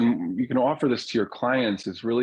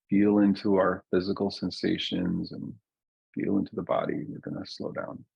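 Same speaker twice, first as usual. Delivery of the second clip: guided meditation. Real, not acted. Teacher settling a group into their bodies, calm guided meditation. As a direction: ease listeners down gently, leaving long silences.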